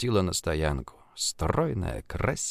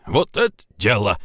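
put-on approving older man; 3 dB louder than usual. A narrator's reading. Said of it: "одобрил будущий тесть".

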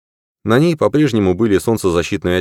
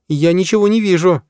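голос Дженни; 11 semitones higher than usual. Acted. Дженни вглядывается в снег.

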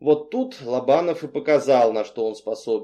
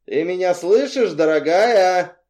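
loud, higher taunting call; slow, sneering call across a distance; mocking; 5 semitones higher, 6 dB louder than usual. A narrator's reading. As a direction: draw out the endearment tauntingly. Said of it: "услышала насмешливый голос".

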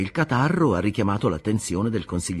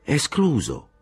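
a quick, higher exclamation of refusal; exclaimed; 7 semitones higher, slower than usual. A narrator's reading. Castorp ruling out meeting his uncle, a light exclamation. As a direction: blurt it out, ruling it out at once. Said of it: "esclamò Castorp".

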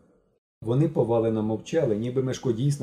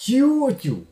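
loud high cry; loud, much higher cry of protest; shout; 14 semitones higher, 7 dB louder than usual. A narrator's reading. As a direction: call out to stop her. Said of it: "закричав Генка".

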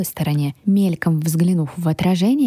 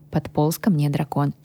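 plain reading voice

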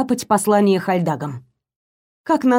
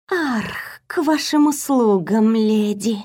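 snake voice; slower than usual. A narrator's reading. Talking snake introduces himself. (шипящий голос змеи)

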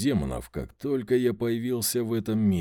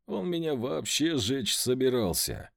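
ordinary delivery, nothing marked

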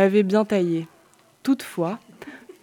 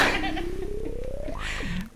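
a burst of high laughter; giggling at the text; 12 semitones higher, 10 dB quieter than usual. Real, not acted. Laugh after the line.

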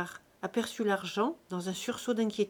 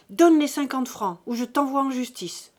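loud, high furious demand; angry threat; 4 semitones higher, 8 dB louder than usual. A narrator's reading. Old father threatening his son with court, loud and furious. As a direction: threaten him, loud and sharp.

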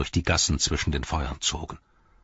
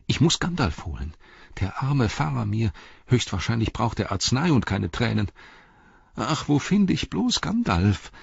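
higher-pitched worried hobbit; troubled inner voice, pitched up; anxious Pippin, fretting; 6 semitones higher than usual. Acted. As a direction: fret to yourself, rising to a plea.

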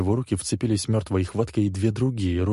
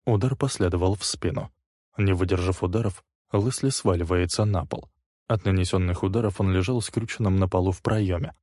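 plain reading voice

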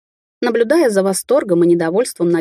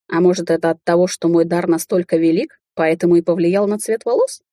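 ordinary delivery

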